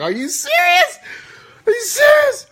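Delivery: very high, loud squealed retort; outraged disbelief. Retold or imitated. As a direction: shriek it in hammed-up disbelief. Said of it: "I was cussing her out".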